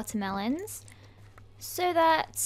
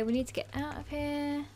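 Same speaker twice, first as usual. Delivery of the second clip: normal talking voice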